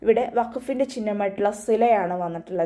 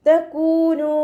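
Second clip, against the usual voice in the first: slow, drawn-out melodic tajweed chant; Quran recitation; 8 semitones higher, 6 dB louder, much slower than usual. Shown as a demonstration. Teacher models melodic Quran recitation for students. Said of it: "രണ്ട് count നീട്ടിക്കൊടുക്കുക".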